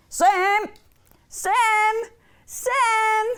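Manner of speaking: high, repeated name call; high-pitched storyteller's call; calling the cat. A narrator's reading. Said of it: "called the cat".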